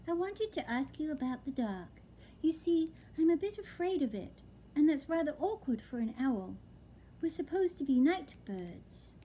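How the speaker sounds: slightly high owlet confiding; lightly voiced owlet. Owlet confessing to the old lady, a bit high. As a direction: admit the fear shyly. Plop's voice in the story.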